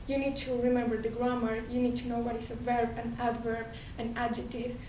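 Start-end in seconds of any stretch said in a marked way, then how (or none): none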